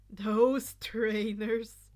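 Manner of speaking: disbelieving drawl. Acted. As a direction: drag out the words slowly and loudly in disbelief. Friend mocking neon trainers.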